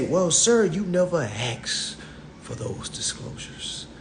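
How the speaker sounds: slow, dropping to a whisper; smug salesman hushing the point; hushed mock salesman; soft dealer voice. Dealer voiced, first take.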